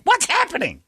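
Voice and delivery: very high alarmed squeak; mock panic. His brain baffled at the show, very high. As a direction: squeak it in confusion. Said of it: "your brain's still like".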